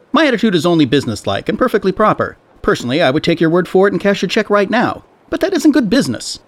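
high, fast, insistent shopkeeper. A narrator's reading.

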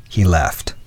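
ordinary delivery, nothing marked

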